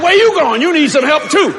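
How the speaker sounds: pin him in place with your voice; commanding. Retold or imitated. Ordering doctor to stay.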